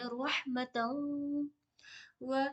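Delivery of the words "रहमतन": रहमतन said very slowly, its तन flowing into the next व; ta merged into waw. Shown as a demonstration. Teacher shows students how to join ta and waw. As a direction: glide the ending of रहमतन into व, very slow. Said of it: "ऐसे मिलाएंगे".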